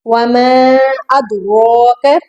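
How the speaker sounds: "अदराक" with a bounced d and full, heavy r; tajweed demonstration. Shown as a demonstration. Teacher modelling a verse, slow "अदराक" with jolted d. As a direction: draw out "वमा", bounce the d in "अदराक". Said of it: "दाल पर झटका देना है".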